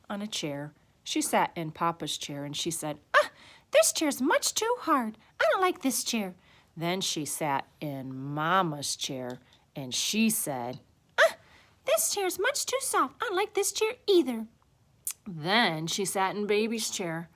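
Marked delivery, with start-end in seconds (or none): 3.1-6.3 put-on high little-girl complaint; fussy child voice, pitched way up; displeased Goldilocks. A narrator's reading. Goldilocks rejecting a chair or bed, high displeased voice. 11.2-14.5 put-on high little-girl complaint; fussy child voice, pitched way up; displeased Goldilocks. A narrator's reading. Goldilocks rejecting a chair or bed, high displeased voice.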